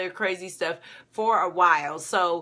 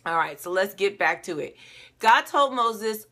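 ordinary talk

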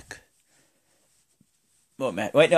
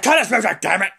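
high, loud mock angry order; hammed-up furious boss yell; Vince; 9 semitones higher, 4 dB louder, faster than usual. Boss ordering the music cut, mock fury. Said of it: "Vince is like".

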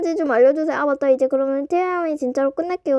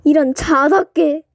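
slow grumble; 4 dB louder, slower than usual. Acted. Slow mock curse.

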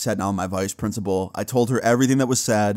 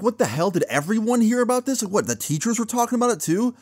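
much higher, stunned disbelief; incredulous shock; 12 semitones higher than usual. Retold or imitated. Student to teacher who accused him, in high shocked disbelief. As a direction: ask it like you can't believe your ears.

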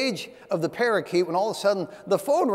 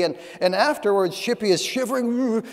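ordinary delivery

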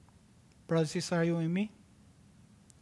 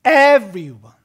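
"everyone" loud and stretched at the start; stress on everyone; 4 semitones higher, 16 dB louder than usual. Real, not acted. Preacher repeating the key word, heavy stress on "everyone". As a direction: punch out "everyone" forcefully, then let it fall. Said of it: "I'm emphasizing the word here".

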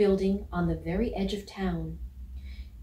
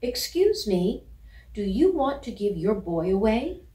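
polite shout; 2 semitones higher, 5 dB louder than usual. Acted. Puppy shouting across the street to a Scotty, polite. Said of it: "shouted at the top of his lungs, but polite as could be".

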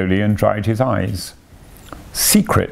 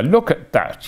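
higher, mildly excited exclamation; crying out, showing off; 7 semitones higher than usual. A narrator's reading. Toad displaying a handful of silver, crying out proudly. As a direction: show off the prize with a lift in pitch. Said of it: "he cried".

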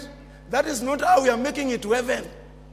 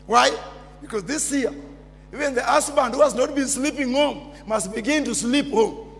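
ordinary delivery

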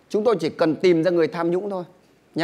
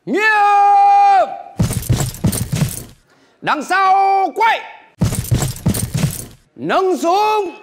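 barked military commands, high and loud; drill sergeant; 11 semitones higher, 3 dB louder, much slower than usual. Pretend. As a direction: shout orders like a firing squad officer. Mock firing squad commands.

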